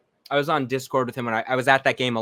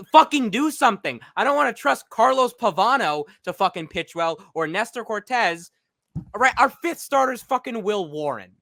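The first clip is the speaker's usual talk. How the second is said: loud, higher, fired-up rant; heated rant; 5 semitones higher, 4 dB louder than usual. Real, not acted. Fan ranting about the Yankees front office, heated and loud. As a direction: vent at the front office, getting louder. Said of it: "I have a rant".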